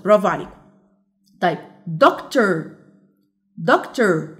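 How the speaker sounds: usual speaking voice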